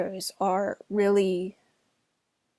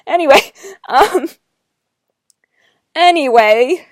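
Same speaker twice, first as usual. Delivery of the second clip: laughing it off; 5 semitones higher, 11 dB louder than usual. Real, not acted. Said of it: "laughing it off".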